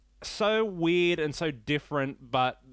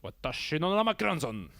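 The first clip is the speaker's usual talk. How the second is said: mock Japanese accent. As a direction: put on an exaggerated Japanese accent. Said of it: "I don't know why I have to do the accent".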